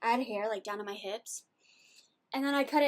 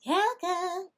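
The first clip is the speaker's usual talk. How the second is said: calling the dog; 7 semitones higher, 6 dB louder than usual. Real, not acted.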